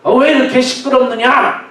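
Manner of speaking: high, forceful order to be still; shouted rebuke. Acted. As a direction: rebuke the wind and sea like unruly people. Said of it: "막 이렇게 책망하듯이 소리를 지른 겁니다".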